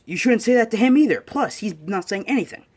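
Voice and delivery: high, loud acted reprimand; indignant scolding. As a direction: tell them off, affronted. Retold character scolds in quarrel.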